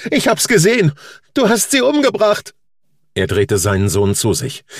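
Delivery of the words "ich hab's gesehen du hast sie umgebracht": put-on a much higher, loud child's voice, furious and accusing; the boy hurling the charge at his father. A narrator's reading. Son accusing his father of pushing her, high and loud.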